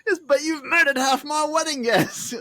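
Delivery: high, put-out complaint; mock-aggrieved father. Father scolds Lancelot over the slaughter, mock aggrieved.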